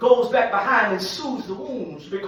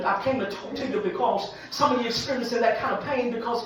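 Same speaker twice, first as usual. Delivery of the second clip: ordinary talk, nothing marked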